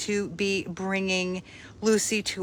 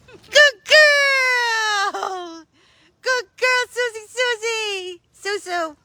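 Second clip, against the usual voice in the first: much higher, squealing with a laugh; excited praise; 12 semitones higher, slower than usual. Real, not acted. Owner praising Susie after she fetches, squealed excited praise. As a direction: gush over the dog like she's the best.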